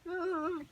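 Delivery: high-pitched whimpering wail; put-on high sob; mock crying. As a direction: cry like a little girl, pretending. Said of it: "like, crying again".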